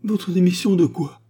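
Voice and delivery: high, loud bewildered wail; whining moan. Acted. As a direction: wail in confusion. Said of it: "gémit monsieur Blaireau".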